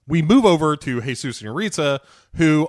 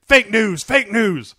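loud high yell, repeated; 8 semitones higher, 5 dB louder than usual. Retold or imitated. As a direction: shout it out twice. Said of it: "he just yells".

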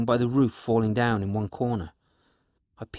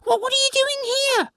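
shrill little creature defending its home; pixie cry; 6 dB louder than usual. Acted. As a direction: pixie crying out at intruders in its house. Pixie confronts intruders.

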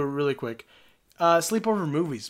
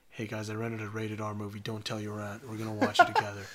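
lower, softer sneaky uncle voice; hushed conspiratorial uncle; 6 semitones lower, 7 dB quieter than usual. Retold or imitated. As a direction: let the kids in on a secret. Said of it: "he would just be like".

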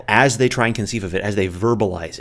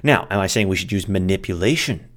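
normal talking voice

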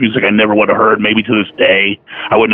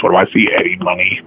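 usual speaking voice

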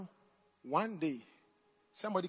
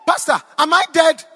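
shrill panicked shouting, much higher and louder; panicked scream; 9 semitones higher, 16 dB louder than usual. Retold or imitated. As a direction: shriek in panic as if waking in heaven. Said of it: "She screamed".

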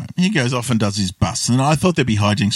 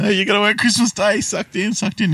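high-pitched mock taunt; gloating jeer; 11 semitones higher than usual. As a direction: have a go at him, pitched right up. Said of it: "Stan has a go at him".

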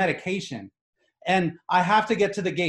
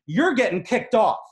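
high, louder put-on threat; mock outraged woman; 6 semitones higher, 4 dB louder than usual. Passenger turning to tell him he's kicked off, imitated high indignant voice. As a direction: jab it at him like the furious passenger. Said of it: "looks at me again and goes".

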